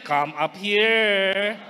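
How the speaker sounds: slow, drawn-out come up here; slow model phrase. Shown as a demonstration. Preacher models the phrase for the congregation, slow. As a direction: say come up here slowly for the neighbour to repeat. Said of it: "Pakisabi po sa katabi".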